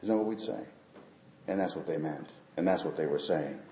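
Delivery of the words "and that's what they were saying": ordinary delivery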